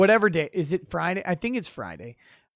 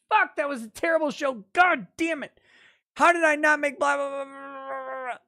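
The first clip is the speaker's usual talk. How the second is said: higher, exasperated mock complaint fading to a whine; mock frustrated self; 7 semitones higher than usual. Streamer imitating himself after a chat-less show, mock frustration. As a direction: play your future self grumbling after a bad show. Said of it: "I'm gonna go".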